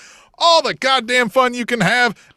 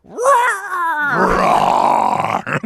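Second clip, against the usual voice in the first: mock roar; 7 semitones higher than usual. Cohost cheering dinosaur's return.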